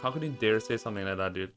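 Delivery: ordinary talk, nothing marked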